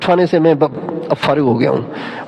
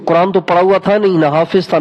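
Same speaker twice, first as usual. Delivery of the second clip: normal talking voice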